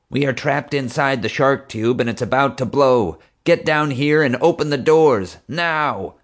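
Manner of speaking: high, urgent radio command; calling for security. A narrator's reading. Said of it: "called to her security men".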